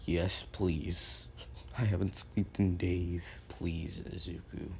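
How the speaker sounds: low, slow, worn-out begging; weary pleading. Retold or imitated. Aizawa begging Izuku to put him to sleep, weary. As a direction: plead like someone drained of sleep, voice sinking away.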